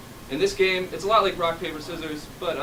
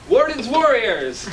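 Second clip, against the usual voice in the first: high, loud, drawn-out team name; stretched rousing call to the crowd; announcer introduction; 9 semitones higher, 7 dB louder, slower than usual. Real, not acted.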